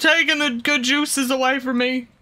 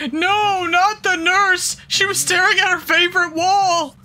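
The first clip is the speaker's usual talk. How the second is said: high, loud protest; dismayed shout; 8 semitones higher, 5 dB louder than usual. Real, not acted. Player yells at the screen about the nurse, high and loud. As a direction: cry out that she's ruined.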